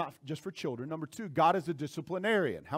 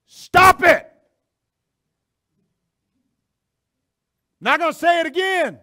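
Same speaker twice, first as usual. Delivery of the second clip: sudden full-force shout, much higher; raised-voice roar; 9 semitones higher, 13 dB louder than usual. Acted. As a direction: roar it like an angry lion. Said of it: "raises his voice".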